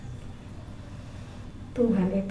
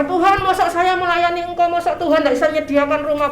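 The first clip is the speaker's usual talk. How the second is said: higher, reproachful mock prayer; insolent prayer; 6 semitones higher, 4 dB louder, faster than usual. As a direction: complain to God, demanding. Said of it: "nggak boleh doa".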